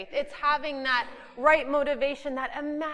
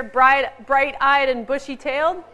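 wide awake and alert; 8 dB louder than usual. Real, not acted. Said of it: "We say it in Canada".

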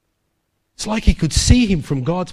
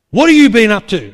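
loud, raised demand; retold confrontation; 4 semitones higher, 10 dB louder than usual. Colleague confronting Paul in his office, loud accusing question. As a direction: burst out with it, loud and higher.

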